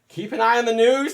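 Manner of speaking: high, loud mocking echo of advice; mocking quote. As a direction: parrot the advice back with scorn. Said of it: "they said".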